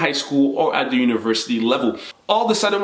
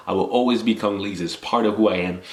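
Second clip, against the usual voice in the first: ordinary talk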